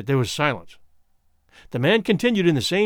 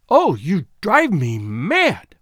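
slow, louder, exasperated outburst; impotent wrath; 4 dB louder, much slower than usual. A narrator's reading. Teresa to Mesner, fed up with his calm, in impotent wrath. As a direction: draw it out in helpless fury. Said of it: "wringing her hands in impotent wrath".

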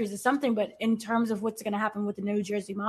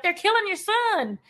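much higher, mock alarmed cry; retold urgent warning; 9 semitones higher than usual. Reviewer quoting Hannah warning about the son, mock alarm.

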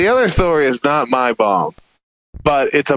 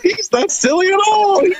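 put-on Silly Boy scolding. Comic in character scolding the heckler, very high. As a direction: protest in the silly persona. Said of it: "I go".

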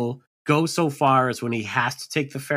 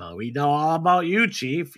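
higher-pitched old New Englander impression; Bad Hat Harry; 5 semitones higher than usual. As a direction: put on an old local's voice, pitched up. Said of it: "Bad Hat Harry is prototypical New Englander".

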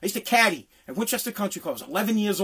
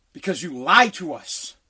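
usual speaking voice

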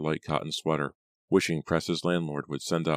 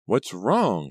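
higher, louder show of interest; feigned interest; 5 semitones higher, 5 dB louder than usual. A narrator's reading. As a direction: try to sound interested. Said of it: "trying to sound interested".

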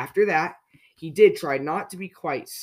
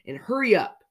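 higher, impatient snap; snapped; 7 semitones higher, 4 dB louder than usual. A narrator's reading. Mister Grumpy rushes Miss Fairy, impatient snap. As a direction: hurry them along crossly, pitch raised. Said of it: "snapped Mister Grumpy".

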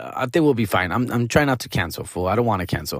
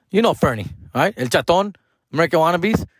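usual speaking voice

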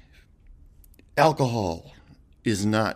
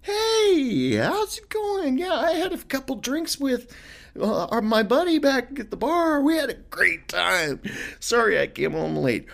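high, cheery tipsy voice; happy drunk. Acted. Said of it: "Are you a happy drunk?".

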